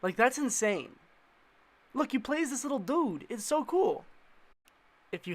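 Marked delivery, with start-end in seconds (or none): none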